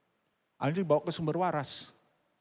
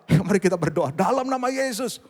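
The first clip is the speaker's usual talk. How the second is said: loud, raised praying voice; re-enacted prayer; 6 semitones higher, 8 dB louder than usual. Pastor leading a worried mother in prayer, loud and fervent. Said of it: "Terus saya doa".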